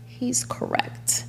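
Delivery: soft unvoiced whisper to herself. Telling herself the truth, whispered. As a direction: breathe it out as a private thought. Whispered inner thought to self.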